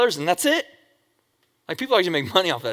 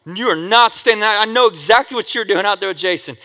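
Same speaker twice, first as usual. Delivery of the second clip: louder stern mom; scolding mom; 4 semitones higher, 6 dB louder than usual. Retold or imitated. Mom refusing her son's sleepover, louder stern scolding. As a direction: lay down the law.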